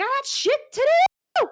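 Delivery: loud, very high screech of an overworked deckhand; frazzled shout. Acted. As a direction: shout back as if losing it. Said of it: "about to lose his ever-loving marbles".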